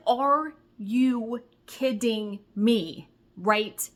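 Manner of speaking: slow, stretched disbelief; slow drawn-out are you kidding me; incredulous. Retold or imitated. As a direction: drag it out in disbelief.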